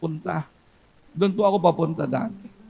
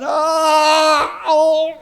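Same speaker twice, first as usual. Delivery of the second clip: mock anguished cries; 7 semitones higher, 8 dB louder than usual. Preacher voicing screams in hell.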